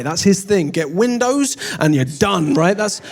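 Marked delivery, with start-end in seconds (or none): none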